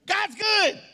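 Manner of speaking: high, loud whoop of joy; pumped shout. Retold or imitated. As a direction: cheer like you just won. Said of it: "but I was pumped".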